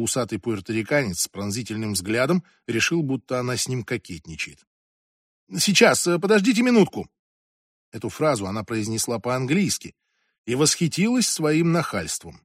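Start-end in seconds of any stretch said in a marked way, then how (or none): 5.6-7.1 raised, higher voice; hurried-sounding call to someone at the door. A narrator's reading. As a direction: call through a closed door. Calls through the door.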